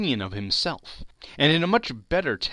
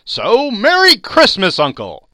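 much louder, higher festive cry; cheery defiance; 11 semitones higher, 15 dB louder than usual. Acted. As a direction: fling the greeting at him. Nephew's defiant parting greeting.

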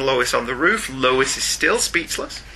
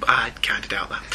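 ordinary talk, nothing marked

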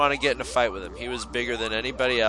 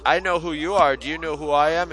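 raised, mildly confrontational retold voice; mild challenge; 5 semitones higher, 5 dB louder than usual. Merle to Darren on the CB, mock challenge. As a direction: imitate a tough guy challenging someone.

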